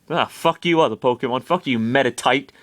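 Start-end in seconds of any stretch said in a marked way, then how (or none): none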